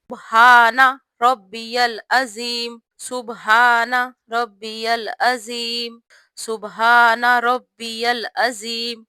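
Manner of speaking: slow, every syllable clear; slow careful recitation. Shown as a demonstration. Teacher showing sisters the correct ruku tasbeeh, slow and clear. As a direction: spell out each word of the tasbeeh slowly. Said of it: "اس طریقے سے".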